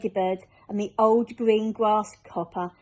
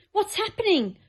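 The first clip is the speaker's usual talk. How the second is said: high alarmed cry; startled boy crying out; 12 semitones higher than usual. Acted. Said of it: "cried James".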